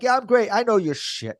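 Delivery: very high, loud, beaming reply; mock-chipper answer to the neighbor; over-the-top fake cheer. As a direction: crank the fake happiness way up. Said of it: "I'm like".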